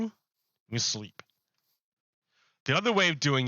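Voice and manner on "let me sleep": sleepy. Real, not acted. Character trying to sleep in game.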